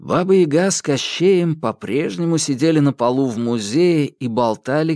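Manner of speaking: ordinary delivery, nothing marked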